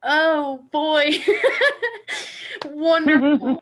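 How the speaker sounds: high, loud, emotional laugh; overwhelmed with emotion. Real, not acted. Daughter to her mom after her loving words, high overwhelmed laugh. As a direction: gush it out, moved to tears.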